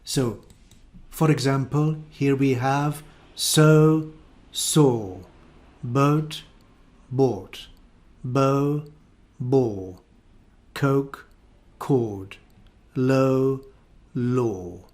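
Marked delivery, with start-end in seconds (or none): 3.3-14.8 "so" against "saw", glide O then long O, slow; RP minimal pairs. Shown as a demonstration. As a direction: contrast each pair in RP. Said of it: "hear the difference".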